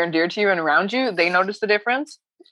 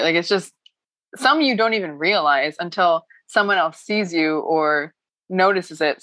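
normal talking voice

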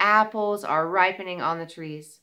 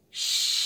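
faucet shush. Shown as a demonstration. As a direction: let the air out like a tap. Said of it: "Out your mouth".

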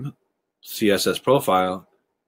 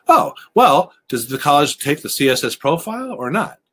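mock innocent parent; 7 semitones higher than usual. Parent coyly questioning a pushy annuity salesman, high and innocent. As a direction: ask it like a wide-eyed innocent. Parent's suggested reply to advisor.

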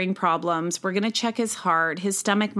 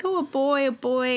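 higher, delighted cry of a new dad; thrilled; 6 semitones higher than usual. Retold or imitated. New parents at the birth, thrilled and pitched up. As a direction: pitch it up with joy at the news. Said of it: "Dave was so thrilled".

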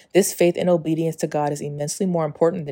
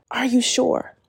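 higher, unsure asking voice; doubtful self-quote; 8 semitones higher, slower than usual. Retold or imitated. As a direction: ask it as if doubting yourself. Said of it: "And I still said".

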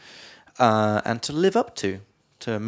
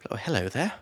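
posh English accent; 6 dB quieter than usual. Shown as a demonstration. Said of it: "lean into my English accent".

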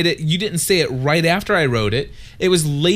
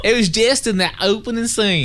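higher-pitched, hammed-up Southern twang; exaggerated Southern accent; 6 semitones higher than usual. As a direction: tease with a thick mock Southern accent. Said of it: "You like that?".

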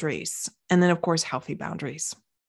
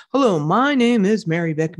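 a lightly put-on, stiff scripted introduction; mock the polished sales voice you think you should use; stiff fake intro. Shown as a demonstration. Coach showing a fake marketing intro, lightly stiff and formal. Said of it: "That's not how I talk".

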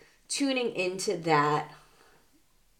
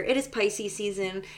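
ordinary talk, nothing marked

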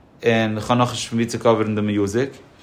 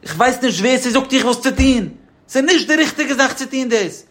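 high-pitched mock screaming tirade, held up high throughout; acted screaming rant; 12 semitones higher than usual. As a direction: act out someone yelling in a worked-up fit. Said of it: "beruhig, schreist du".

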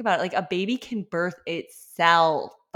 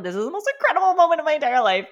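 high, fast, breathless rush of awe; ecstatic quote; 9 semitones higher, 7 dB louder, much faster than usual. Retold or imitated. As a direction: gush it out at the height of joy, much higher and louder. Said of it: "I was like".

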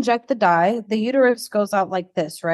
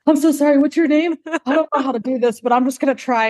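hammed-up flustered apology, pitched up; imitating huffing and puffing; 5 semitones higher, faster than usual. Late technician apologizing to his patient, mock huffing and puffing. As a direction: play a winded, late technician stumbling in. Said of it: "he was huffing and puffing".

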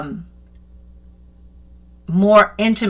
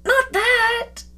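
much higher, mock protesting; pleading with God; 4 dB quieter than usual. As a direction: whine it like a child refusing. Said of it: "you're like".

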